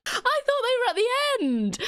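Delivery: very high, incredulous complaint; high-pitched disbelief; exasperated outburst. Real, not acted. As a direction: squeal it in protest. Complains about where letters are listed.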